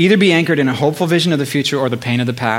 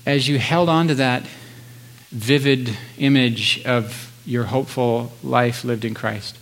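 soft spa whisper; 2 semitones lower, 4 dB quieter than usual. Acted. Leads a guided meditation.

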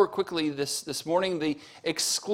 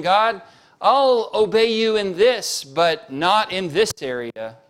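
slow, loud, higher defiant plea to God; stubborn believer; 5 semitones higher, 7 dB louder, slower than usual. Acted. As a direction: bargain with God, dragging it out. Preacher voicing a stubborn believer.

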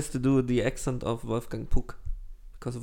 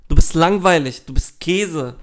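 loud, emphatic playground taunt; punchy, half-serious trash talk; mock insulting; 3 semitones higher, 8 dB louder than usual. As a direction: hurl it as a playful diss. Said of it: "jetzt wird richtig beleidigt".

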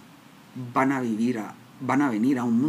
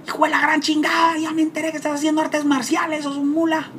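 much higher, louder mock angry yell; father's angry insult; 13 semitones higher, 3 dB louder than usual. Father yelling insults at his son over martial arts. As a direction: rage at him like an abusive father. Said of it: "mi papá se entera y me dice".

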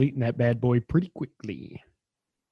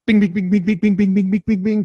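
trophy chime noises; 7 semitones higher, 5 dB louder than usual. Retold or imitated. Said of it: "it's like".

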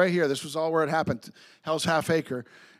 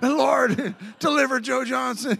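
laughing mock prayer; 5 semitones higher, slower than usual. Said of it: "I mean, every".

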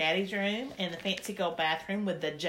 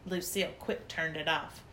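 usual speaking voice

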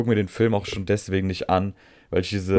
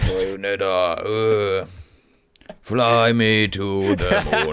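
slow, husky seductive purr; sultry Bayonetta voice; much slower than usual. Pretend. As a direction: drawl it slow and sexy.

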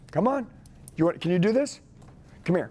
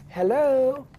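playing the customer on the phone; 8 semitones higher, 3 dB louder than usual. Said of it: "I'm gonna role play with you".

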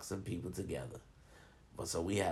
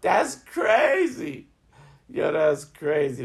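loud, high, drawn-out disbelief; amazed exclamation; 8 semitones higher, 12 dB louder, slower than usual. Real, not acted. Reacting to a churchgoing friend's wild life, loudly amazed. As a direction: cry out slowly, stretching the words in amazement.

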